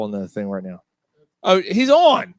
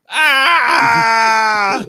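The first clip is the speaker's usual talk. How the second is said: loud, high excited yell; excited shout; 10 semitones higher, 10 dB louder than usual. Real, not acted. Hearing his voicemail, lets out a loud excited yell. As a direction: burst out with a shout of delight.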